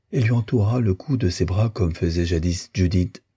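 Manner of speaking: ordinary delivery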